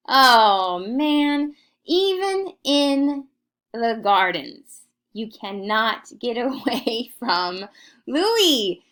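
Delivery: exasperated guide groaning that Louis is everywhere; mock exasperation. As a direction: throw up your hands at Louis everywhere.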